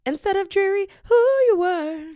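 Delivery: mock dreary. Mocking dreary old self.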